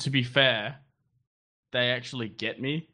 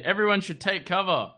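higher, urgent warning call; alarm announcement; 7 semitones higher than usual. Retold or imitated. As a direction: sound the alarm to everyone. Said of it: "And they say".

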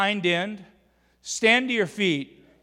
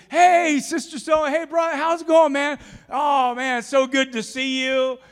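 high, overly bright mock hello, much higher; hammed-up two-faced greeting, pitched up; fake friendly greeting; 7 semitones higher, 4 dB louder than usual. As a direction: gush with phony warmth at church folk. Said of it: "it doesn't look like this".

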